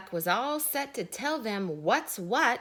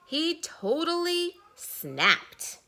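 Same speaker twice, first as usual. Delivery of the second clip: building climax; 9 semitones higher, 4 dB louder, slower than usual. A narrator's reading. Narrator builds to Duck snapping.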